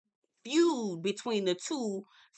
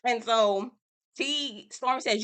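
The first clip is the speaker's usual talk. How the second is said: usual speaking voice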